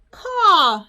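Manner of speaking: high, loud playful crow caw; bird caw. Pretend. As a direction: squawk it like a crow. Reacting to birds pattern winner.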